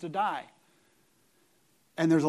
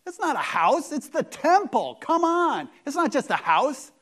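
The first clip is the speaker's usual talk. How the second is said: mock indignation; 7 semitones higher, 5 dB louder than usual. Preacher mocks calling temple house.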